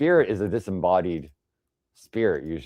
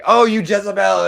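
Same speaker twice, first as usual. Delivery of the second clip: mock accusation; 11 semitones higher, 9 dB louder than usual. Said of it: "You don't wanna, like, say".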